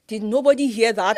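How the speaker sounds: loud, laughing outrage; mock horror through laughter; laughing disbelief. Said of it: "I'm just like".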